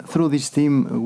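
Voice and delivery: normal talking voice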